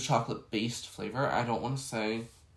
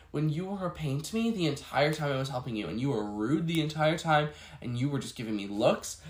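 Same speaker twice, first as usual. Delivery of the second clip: ordinary talk, nothing marked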